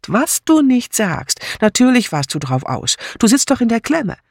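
high, sneering cousin; mocking taunt. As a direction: needle her with sly mockery. Julia taunting Philippa.

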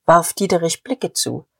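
ordinary delivery

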